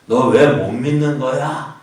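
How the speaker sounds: loud, slow, scolding question; mock rebuke. Preacher voicing a rebuke Thomas never got, loud scolding. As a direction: scold him like a stern teacher, drawn out. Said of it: "라고 책망하는 것이 아니에요".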